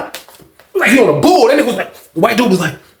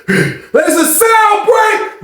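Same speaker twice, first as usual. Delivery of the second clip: much higher, very slow shout; drawn-out call; 12 semitones higher, much slower than usual. Retold or imitated. Predator shouts to the booth, drawn-out call.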